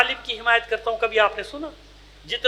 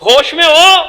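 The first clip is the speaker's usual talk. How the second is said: loud, sharp reprimand; scolding; 3 semitones higher, 6 dB louder than usual. Real, not acted. Preacher scolds those spoiling funeral prayers, loud rebuke. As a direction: shake them awake with a rebuke.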